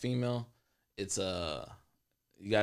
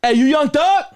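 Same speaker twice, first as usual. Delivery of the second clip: much higher, loud call; excited shout; 10 dB louder, slower than usual. Retold or imitated. Fan shouting at [name] in Walmart, high and loud. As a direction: call out across the store, thrilled. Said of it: "That's how I said it".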